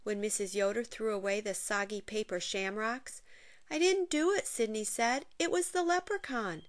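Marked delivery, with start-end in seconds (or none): 3.7-4.5 high, pleading protest; a boy's indignant denial. Acted. Accused boy protests. 5.4-6.6 high, pleading protest; a boy's indignant denial. Acted. Accused boy protests.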